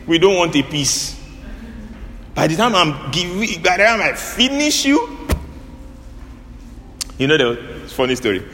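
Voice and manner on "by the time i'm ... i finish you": a high, taunting threat; mock a bully, voice up high; high, gloating mock threat. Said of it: "Some people say".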